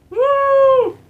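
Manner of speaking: very high, loud joyful whoop; triumphant whoop. Real, not acted. Gamer finally clearing the course, loud high whoop. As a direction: let out a victory whoop.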